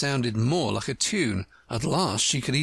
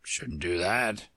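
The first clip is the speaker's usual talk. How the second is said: lower, softer slow frog mutter; 4 semitones lower, 4 dB quieter, slower than usual. Acted. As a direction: grumble it under his breath. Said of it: "the frog muttered".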